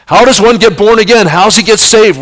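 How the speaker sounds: rising preacher's call; preaching fire. Acted. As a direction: ring it out to the pews.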